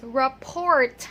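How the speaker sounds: "report" stretched, with a sounded final T; common mispronunciation. Retold or imitated. Teacher showing how most people say it, T mispronunciation. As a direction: finish "report" on an audible T. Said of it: "most people pronounce this word as".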